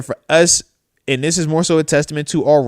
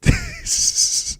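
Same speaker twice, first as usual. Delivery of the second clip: high laugh; 5 dB quieter than usual. Real, not acted. Laughs after the gray hair bit.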